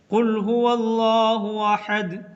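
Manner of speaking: slow, melodic tajweed chant; धीमी तिलावत की आवाज़; Quran recitation. Shown as a demonstration. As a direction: recite it slowly like tilawat. Recites Quran verse as example.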